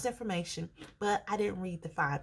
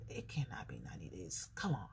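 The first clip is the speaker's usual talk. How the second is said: low, defeated groan; 12 semitones lower, 10 dB quieter than usual. Retold or imitated. Frustrated thought.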